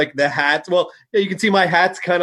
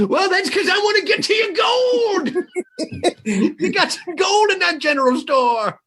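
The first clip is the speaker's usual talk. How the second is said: laughing; 12 semitones higher than usual. Real, not acted. Laughing through joking reply.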